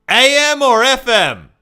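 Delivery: high, loud confused call; loud high boozy question; homeless fella asking. Retold or imitated. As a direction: ask it like a muddled drunk. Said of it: "And he goes".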